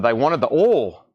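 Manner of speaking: oil drawled as a slow southern ol; oil said as ol in a southern accent. Retold or imitated. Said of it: "my southern accent".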